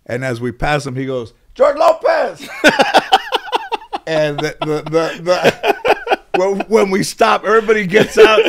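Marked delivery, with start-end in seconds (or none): 1.5-2.5 high, loud shouted name; startled shout of recognition; yelling a name. Retold or imitated. Homeless guy yells his name. 2.5-4.0 loud, high real laugh; helpless high laugh; high burst of laughter. Real, not acted. Laughing at the memory. 4.1-8.4 high words broken by laughter; giggling through the story; laughing through words. Real, not acted. Laughing while telling it.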